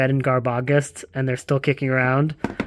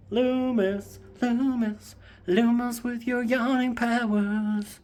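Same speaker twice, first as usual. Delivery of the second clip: high, slow mock-epic address; dramatic calling; 7 semitones higher, 5 dB quieter, slower than usual. Addressing the yawning cat Loomis in a mock-epic voice. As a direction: call the cat like a hero.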